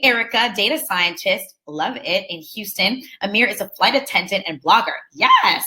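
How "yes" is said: a quick, much higher, louder yes; excited yes. Real, not acted. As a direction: cheer the attendee on with a sudden yes. Cheering an attendee's intro.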